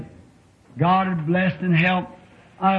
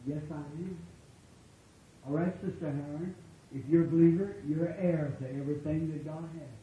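soft, calm address; soft, calm words to a sick sister; quiet demo; 12 dB quieter than usual. Shown as a demonstration. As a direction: say it gently and simply. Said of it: "I say".

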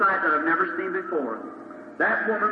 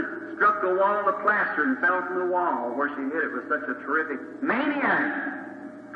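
ordinary talk, nothing marked

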